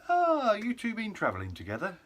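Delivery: high, loud chirpy official voice; mock-friendly customs staff asking politely; airport official. Airport staff greeting two travellers, chirpy high voice. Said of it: "and they're like".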